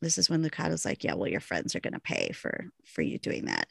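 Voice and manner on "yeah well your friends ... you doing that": much lower, softer menacing lizard growl; villain threatening revenge, voiced low and reptilian; lizardy and angry. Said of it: "gets a little lizardy and angry".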